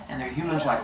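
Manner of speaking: usual speaking voice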